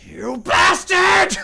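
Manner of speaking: high, drawn-out full shout; furious yelling. Acted. Monsters yelling after the one escaping through the portal, furious shout. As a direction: scream the insult long and loud. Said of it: "them both essentially yelling".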